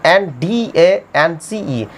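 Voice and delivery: slow, letter-by-letter dictation; dictating each letter clearly; spelling out letters. Shown as a demonstration. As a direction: spell it out slowly for students. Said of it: "spelling".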